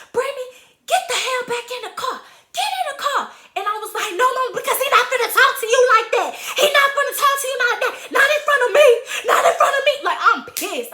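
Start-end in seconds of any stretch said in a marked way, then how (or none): 0.1-3.3 high, urgent imitation of a mother; mock-mom command, high and quick; scolding mother. Mother ordering daughter, acted. 4.1-9.9 high, loud, rushed protest; retold furious outburst, pitched way up; crying mad. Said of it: "I'm crying".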